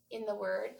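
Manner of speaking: ordinary delivery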